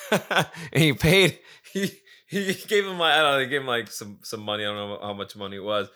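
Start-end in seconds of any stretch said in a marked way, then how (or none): 0.1-3.4 laughing. Real, not acted. Laughing while retelling.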